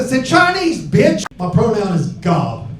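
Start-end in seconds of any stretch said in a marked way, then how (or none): 0.0-1.2 higher comeback. Retold or imitated. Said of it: "I said".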